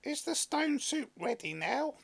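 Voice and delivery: high eager question; high and impatient; couple's voice. A narrator's reading. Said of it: "the woman and her husband asked".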